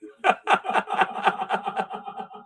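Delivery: breathy villain chuckle; sinister laugh. Acted. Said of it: "with that sinister laugh".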